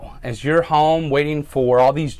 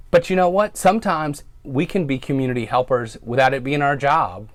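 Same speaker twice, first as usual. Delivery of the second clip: ordinary talk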